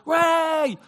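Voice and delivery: put-on high, loud kid's cheer; child cheering as they leap to be caught; child's cheer. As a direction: shout it like a jumping child. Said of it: "they go".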